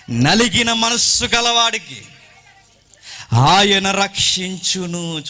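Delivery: high, ringing pulpit reading; scripture declamation. Real, not acted. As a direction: proclaim the verse to the congregation.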